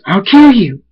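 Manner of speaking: loud, high acted threat; angry shout. A narrator's reading. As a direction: shout it in high-pitched fury. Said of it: "full of anger".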